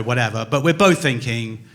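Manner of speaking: ordinary talk, nothing marked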